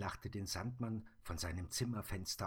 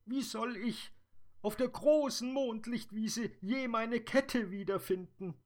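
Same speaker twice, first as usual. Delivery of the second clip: high, louder tearful fairy voice; weeping fairy; 6 dB louder than usual. Acted. Dream fairy weeping to the Sandman, high and tearful. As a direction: cry out the question as the fairy. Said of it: "Da weinte die Traumfee noch bitterlicher".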